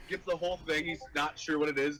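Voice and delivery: usual speaking voice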